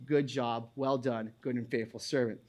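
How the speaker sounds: soft, low and tender, like God praising a faithful servant; a hushed, kindly voice of God; God speaking gently. Pretend. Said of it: "God will say".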